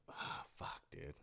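soft, barely voiced groan; muttered curse. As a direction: mutter it to yourself. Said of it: "I'm like".

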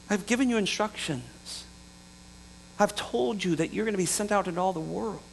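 soft, quiet disappointed questioning; gentle rebuke. Retold or imitated. Jesus gently asks wandering disciples why, quiet. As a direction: ask it softly, almost hurt.

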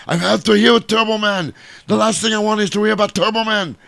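high-pitched comic action-star impression; Arnold impression. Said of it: "And Arnold's like".

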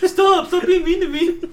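very high mock-wounded plea; hurt Martian Manhunter. Playing the heckled hero begging for mercy, mock hurt. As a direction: plead in a hammed-up high voice, amused.

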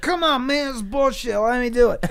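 high pleading whine; drunk whine. Retold or imitated. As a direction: beg like a drunk kid denied. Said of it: "And I'm like".

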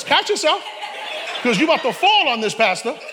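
half-laughing street-style warning to himself; street talk, laughing. Retold or imitated.

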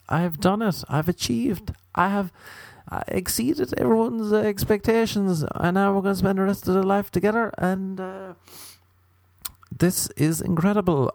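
high, fast, overjoyed groom; gushing newlywed. Pretend. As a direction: gush like a groom who can't believe his luck. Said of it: "and you're like".

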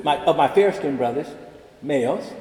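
ordinary talk

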